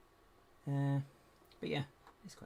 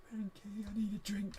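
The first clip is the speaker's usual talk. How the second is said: light quote voice; 10 semitones higher than usual. Retold or imitated. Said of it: "Like I say".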